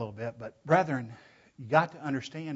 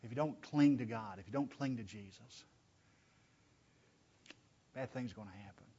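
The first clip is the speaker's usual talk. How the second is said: soft, lowered, earnest caution; loving warning; 4 semitones lower, 8 dB quieter than usual. Acted. Joshua warning the brethren to cling to God, soft loving warning. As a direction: plead gently, letting it sink quieter. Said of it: "It's a loving warning".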